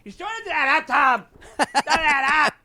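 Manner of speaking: put-on high, indignant drunk accusation; tipsy Darcy accusing. Imitating Darcy confronting Tom, high and indignant. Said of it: "Darcy's like".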